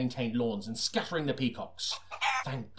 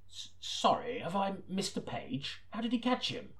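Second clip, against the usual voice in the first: high, puzzled and hushed; confused; 8 semitones higher, 6 dB quieter than usual. Real, not acted. Reader breaking off to ask the producer, puzzled and high. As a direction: stop reading and question the page. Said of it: "reader breaks off confused".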